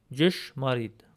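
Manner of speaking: Ճշմարիտ repeated, falling, same careful sounds; Armenian pronunciation. Shown as a demonstration. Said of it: "This word is pronounced".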